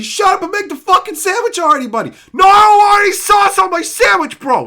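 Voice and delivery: screaming rant, high and loud; enraged shouting; furious shout. Real, not acted. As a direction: yell it at chat, furious. Ranting yelling at chat.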